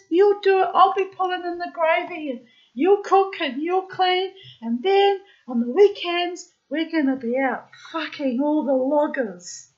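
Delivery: high, loud excited sales pitch; imitating an overexcited friend; insistent friend. Acting out friend selling the plan.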